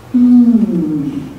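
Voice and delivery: long, loud hummed M; sustained M hum. Shown as a demonstration. Author shows the beginning of madre, sustained hum. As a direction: hum a pure M and hold it. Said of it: "start with the sound M".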